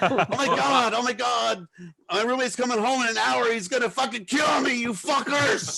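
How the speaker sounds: high wail building to a stretched-out yell; mock panic. Guy fearing his roommate over a broken window, mock panicked yelling. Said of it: "some guy in the background go".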